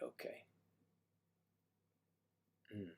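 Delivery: normal talking voice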